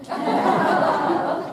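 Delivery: high-pitched burst of laughter. Real, not acted. Amused at the crying man's shirt, laughing. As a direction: laugh out at the joke.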